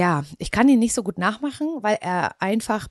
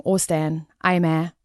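Mecklenburg accent; slower than usual. Shown as a demonstration. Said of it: "Alles sehr langgezogen".

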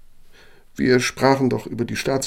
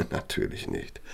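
soft amused laugh; 4 semitones lower, 6 dB quieter than usual. Acted. Amused denial of the innuendo.

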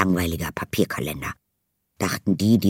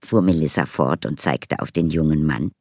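ordinary talk

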